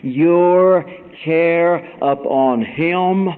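high, loud preacher's shout building up; preaching at full force. Acted. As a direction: belt it out like a revival preacher. Preacher shouting the scripture theme.

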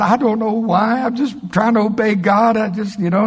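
high, nervous, rushed excuse; explaining himself to the lady at dinner; flustered excuse. Retold or imitated. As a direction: babble it, embarrassed.

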